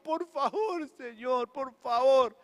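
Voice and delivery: a hint of begging, high on the first favor; demonstrated begging prayer; pleading prayer. Shown as a demonstration. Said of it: "Es como".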